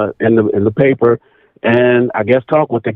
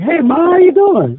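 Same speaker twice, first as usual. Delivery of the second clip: high-pitched imitated greeting; retold greeting. Grandson's mother greeting her own mother, imitated high. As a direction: pitch it way up, calling out to Ma. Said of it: "she calls her name".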